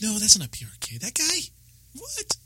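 high-pitched disbelief, climbing as it goes; incredulous. Retold or imitated. Acting out his surprised reply at party.

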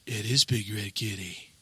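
low, slow, hushed discovery; quiet gasp of recognition; hushed realization. Retold or imitated. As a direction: let it dawn on you quietly. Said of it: "I'm like, gasps".

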